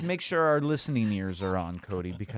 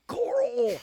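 squeaky high voice. Pretend. Jokey voice play on name.

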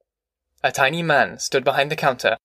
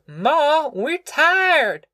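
put-on high, drawn-out child's wail; 13 semitones higher, much slower than usual. A narrator's reading. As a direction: wail it like a tired little girl. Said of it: "Ruthie wailed".

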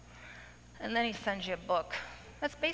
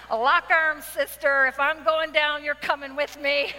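louder, laughing call to her friends; playful rallying cry; 4 semitones higher, 6 dB louder than usual. Retold or imitated. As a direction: drag your girlfriends along with a laughing battle cry.

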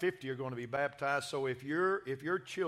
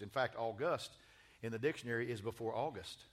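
ordinary talk, nothing marked

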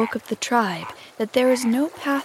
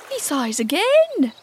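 higher, slow, lightly voiced pirate; wondering pirate; 6 semitones higher, 3 dB louder, slower than usual. A narrator's reading. Starkey noting the captain's repeated sigh, wondering. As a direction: lift it slowly in wonder.

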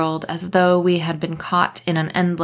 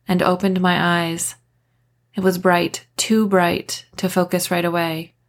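plain reading voice

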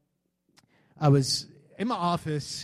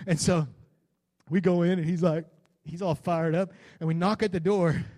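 normal talking voice

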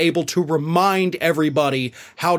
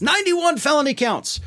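much higher, exclaimed in disbelief; incredulous repeat; 8 semitones higher than usual. Retold or imitated. Host quoting a comic on Trump's charges, incredulous. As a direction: hit it higher, as if astonished.

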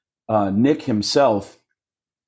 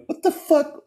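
high, stunned what the fuck; shocked outburst. Retold or imitated. Comic retelling his shock at [name]'s joke, stunned and high. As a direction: blurt it out in disbelief.

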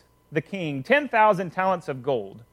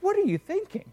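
dumbfounded; 13 semitones higher, 4 dB quieter than usual. Acted. King dumbfounded at Tommy.

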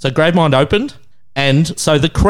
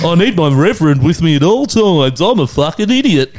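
raised, sing-song ridicule; mocking impression; 3 semitones higher, 4 dB louder than usual. Host ridicules man flying his advisor over, mocking voice. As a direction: send up a needy believer. Mocks man with travelling reverend.

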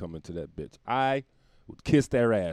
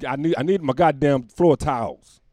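mock angry demand; 5 semitones higher, 10 dB louder than usual. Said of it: "acting ass".

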